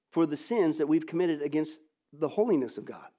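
normal talking voice

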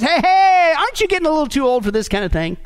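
high, quick, nagging call; high "hey, hey", then hurried needling question; tempting inner voice. Acted. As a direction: butt in like a meddling tempter. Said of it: "you hear this voice".

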